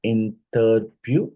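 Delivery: "Interview" very slowly in three separate syllables. Shown as a demonstration. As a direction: count out three beats in "Interview". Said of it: "it's a trisyllable".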